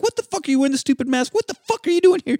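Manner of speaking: put-on shouting the boy out, pitch way up; furious uncle ordering him home; high angry yell. Uncle finds the masked boy, yells high and angry. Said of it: "He's like".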